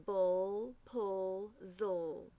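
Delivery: "bull, pull, zul" as slow, separate syllables; schwa plus L. Shown as a demonstration. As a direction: sound out each of "bull, pull, zul" with a held L. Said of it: "you hear the schwa".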